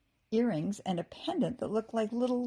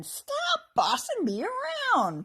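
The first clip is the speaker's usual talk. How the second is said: high, slow, whiny goblin voice; grumbling goblin; 6 dB louder, slower than usual. A narrator's reading. Second goblin grumbling back at the bossy one. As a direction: whine it like a put-upon goblin. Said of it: "grumbled the other one".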